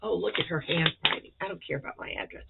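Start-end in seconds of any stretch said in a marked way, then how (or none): 1.4-2.4 quick whispered aside. Real, not acted. Aside while opening package.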